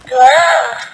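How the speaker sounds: high squealing giggle; a little boy squealing with joy; toddler giggle. Acted. Toddler delighted at the shop window, loud high giggle.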